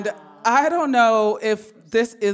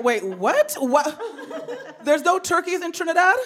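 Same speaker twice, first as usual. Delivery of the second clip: incredulous; 9 semitones higher than usual. Retold or imitated. Son questioning mom's turkey request, high incredulous. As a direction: squeak it out in disbelief.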